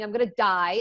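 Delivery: normal talking voice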